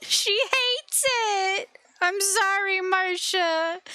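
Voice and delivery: high, drawn-out cooing to a pet; pet-talk coo. Real, not acted. Owner apologising to Marcia for the accent, high pet-talk coo.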